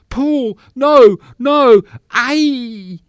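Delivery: very high, loud panicked cries; screaming stage terror. Acted. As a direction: cry out as the victim being killed. Victim being killed on stage.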